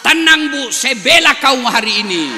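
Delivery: high, loud rallying shout. Real, not acted. Preacher shouting to reassure the wives, loud and high. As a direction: shout it out to the crowd.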